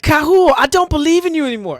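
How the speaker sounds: high, fast, hammed-up hero defiance; dramatic declaration. Commander defying the river god Kahul, hammed-up high cry. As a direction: fling the line out like an anime hero. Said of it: "I had a poor execution when I did this".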